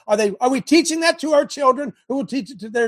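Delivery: high, loud outraged question; indignant preaching shout. Real, not acted. Preacher challenging the congregation, loud and indignant.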